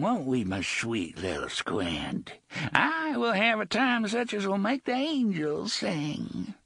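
creepy pirate gloating; Gasher leering. Acted. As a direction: gloat over him, savouring it.

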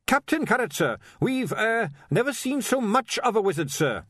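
put-on high, dutiful young guard; earnest watchman. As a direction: report it stiffly.

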